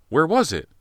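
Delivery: high, loud panicked question; sudden alarm in the voice. A narrator's reading. Gun missing, panic.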